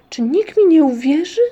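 high, loud desperate question; acted cry of frustration; outburst. A narrator's reading. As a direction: burst out pleading to be believed. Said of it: "wybuchnął Muminek".

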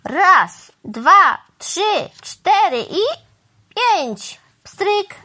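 loud, high count, word by word; calling out a count. A narrator's reading. Crow counts down for the cow before cleaning, calling out. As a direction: count off like a showman before a trick. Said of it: "Pan Wrona zawołał".

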